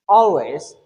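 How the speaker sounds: always with a full, strong AL; long stressed syllable. Shown as a demonstration. Teacher modelling full emphasis, first syllable of always stressed. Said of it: "long enough with full emphasis".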